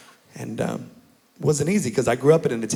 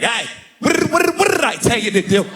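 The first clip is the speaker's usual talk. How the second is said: angry dad; 13 semitones higher than usual. Retold or imitated. Dad yelling at his scared kid, furious. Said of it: "My dad be like".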